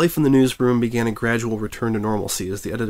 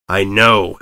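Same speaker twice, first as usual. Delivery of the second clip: growled; 6 dB louder than usual. A narrator's reading. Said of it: "Etta growled".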